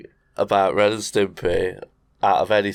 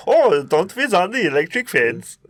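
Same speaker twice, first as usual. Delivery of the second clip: higher cartoon Sven voice, laughing through; cousin Sven voice; 8 semitones higher than usual. Retelling the cousin's entrance, higher silly voice. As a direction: put on a silly Swedish cousin.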